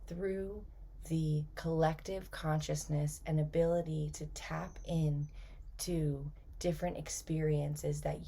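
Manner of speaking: soft, unhurried channeled voice, phrase by phrase; channeling spirit message. Acted. Channels spirit beings to listeners.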